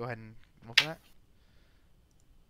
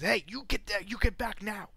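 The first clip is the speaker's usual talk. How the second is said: guard shouting; 8 semitones higher, 4 dB louder than usual. Acted. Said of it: "one of the guards turned towards both of you and says".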